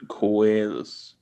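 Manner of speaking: "Koalas" said "ko-ay-las", Australian; Australian accent. Shown as a demonstration. Cohost tries the accent on request, Australian. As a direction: copy the Australian vowel in "Koalas". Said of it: "you sounded so fucking Australian".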